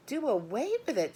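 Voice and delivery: high, loud shriek; girl shrieks at her mother. A narrator's reading. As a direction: shriek it in disbelief. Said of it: "shrieked Fern".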